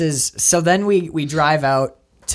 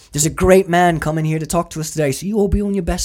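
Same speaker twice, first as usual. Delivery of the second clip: put-on British accent; 5 semitones higher than usual. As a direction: do Combo's line in a British accent, like a boss addressing his gang. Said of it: "I only do my British accent".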